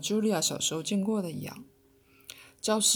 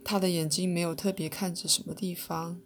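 plain reading voice

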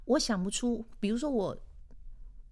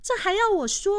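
much higher, louder put-on girlfriend voice; indignant girlfriend; 14 semitones higher, 8 dB louder than usual. Girlfriend snapping back at her boyfriend, high indignant voice. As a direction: squeal it in outrage, playing the girlfriend. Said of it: "然后女生就说".

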